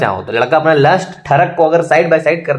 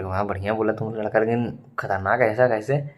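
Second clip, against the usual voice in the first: quiet, much softer murmured question; hushed classroom aside; 5 semitones lower, 10 dB quieter than usual. Retold or imitated. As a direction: keep it hushed so the teacher can't hear. Whispering to classmate during physics. (कक्षा में दबी आवाज़ में फुसफुसाकर)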